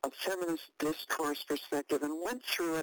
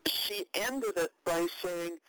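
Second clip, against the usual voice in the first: usual speaking voice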